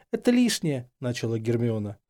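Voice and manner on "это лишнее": high, hesitant objection; anxious protest. A narrator's reading. Hermione declining the apology, high and uneasy. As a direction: gently try to wave it away.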